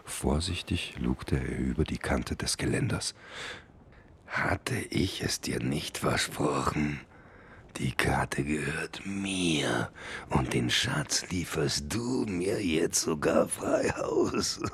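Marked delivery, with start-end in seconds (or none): 4.3-14.6 slow, whispered gloating villain; sinister villain whisper. Acted. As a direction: hiss it slowly like a schemer. Villain gloats over the treasure.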